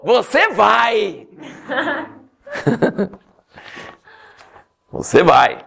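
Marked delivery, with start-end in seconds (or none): 0.0-3.2 slow, laughing through the words; preacher laughing at his own joke. Real, not acted. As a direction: let the laugh take over the punchline.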